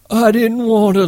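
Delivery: high, tearful plea; Lenny crying to the men, high. Acted. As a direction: plead through tears. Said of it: "Lenny cried".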